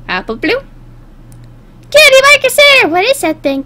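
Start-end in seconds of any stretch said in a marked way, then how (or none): 1.9-2.9 high, loud filly squeal; cartoon team cheer. Voices the little fillies' cheer.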